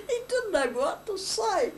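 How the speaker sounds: high pitched, laughing through it. Real, not acted. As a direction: giggle out the words. Teller laughing at memory.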